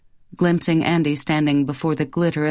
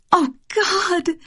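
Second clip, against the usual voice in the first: much higher startled exclamation; shocked at her reflection; high startled dismay; dismayed gasp; 13 semitones higher than usual. Acted. Wife sees herself in mirror.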